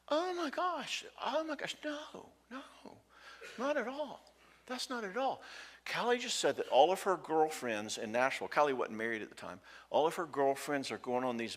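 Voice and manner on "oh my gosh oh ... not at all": much higher, softened reassuring voice; softened reply. Retold or imitated. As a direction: soften and reassure her worried son. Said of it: "she just kinda softens and she goes".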